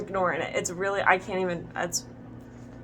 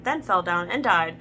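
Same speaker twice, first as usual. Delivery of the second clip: ordinary delivery, nothing marked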